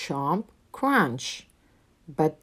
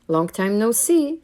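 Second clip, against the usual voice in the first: a bit higher and louder, cheerful; friendly hare greeting; 5 semitones higher, 5 dB louder than usual. A narrator's reading. Hare greeting mouse, cheerful and bright. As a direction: greet an old friend warmly. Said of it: "says Hare".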